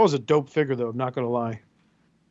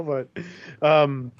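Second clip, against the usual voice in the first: ordinary talk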